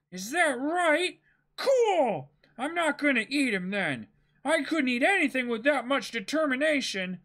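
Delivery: starry-eyed hero, won over; excited young pirate. Acted. Reads Luffy's lines.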